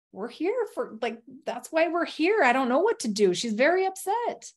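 rising in pitch, incredulous and pleading; exasperated husband. Retold or imitated. Husband tells the stake president she's upset, exasperated and rising. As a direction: push back, a little disbelieving, voice climbing.

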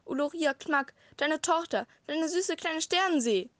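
put-on little girl cries out, begging; crying out. A narrator's reading. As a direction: call out to Papa, desperate. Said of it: "rief das kleine Mädchen".

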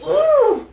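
high, loud ooh of alarm; sharp rising ooh; shocked ooh. Retold or imitated. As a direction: yelp at the screen in shock. Tense movie moment reaction.

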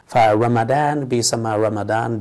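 ordinary talk

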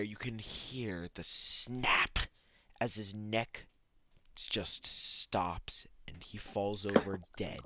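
dramatic hushed narration; whisper. A narrator's reading. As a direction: whisper the grim ending. DM narrates the death.

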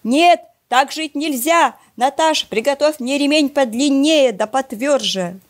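a fed-up dad laying down the law at full volume; father shouting. Acted. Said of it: "а папа кричит".

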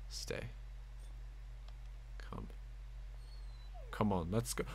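whispered commands. Real, not acted. Commanding dog companion.

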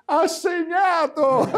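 high, slow goal cry; breathless italian goal commentary. Pretend. Host mimics goal call, huge jump. (telecronaca del gol senza fiato)

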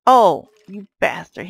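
ordinary talk, nothing marked

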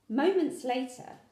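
ordinary delivery

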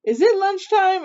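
high, loud questioning dippy cousin. A narrator's reading. Dippy cousin asks Little Witch for food, high questioning voice.

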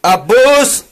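high, loud, drawn-out shout of ABBAS; loud call. Real, not acted. Calling out to Abbas, high loud shout. Calls out a name.

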